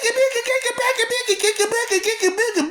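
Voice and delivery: squeaky stammering mimicry; mocking stammer. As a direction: parody a whiny complainer. High mocking stutter.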